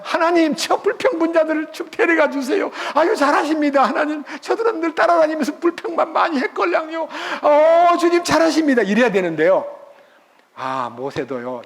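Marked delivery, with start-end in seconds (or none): 0.0-8.8 higher, pleased voice egging God on; a smug Moses cheering God's punishment; pretend Moses. Moses as the preacher would be, cheering God on, higher and pleased. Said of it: "내가 정말 모세라면".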